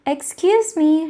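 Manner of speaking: polite shout across the path; called out. Acted. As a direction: call out to get attention. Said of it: "shouted Squirrel".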